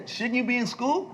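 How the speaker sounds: high, mock-scandalized question; incredulous. Executive questions teenage visitor at his office, incredulous. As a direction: imitate a taken-aback adult.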